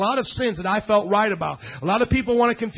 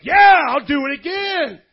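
louder, drawn-out tough-guy brag; cocky boast; 4 semitones higher, 6 dB louder, slower than usual. Acted. Preacher playing his old brawling self, cocky boast. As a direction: crow about it, unrepentant.

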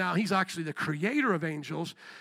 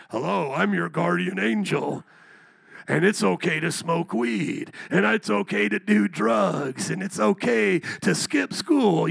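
demon posing as guardian angel; 3 dB louder, slower than usual. Retold or imitated. Preacher mocking a demon angel to the church, slow sly tempter voice. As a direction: drag out each okay like a sweet-talking tempter. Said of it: "your guardian angel's a demon".

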